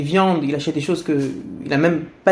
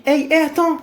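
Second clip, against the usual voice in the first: high, fast angry challenge; big man shouting, fast and high; angry yell at queue-jumper; 8 semitones higher, faster than usual. Retold or imitated. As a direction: snap at him for cutting the line. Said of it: "qui lui crie dessus".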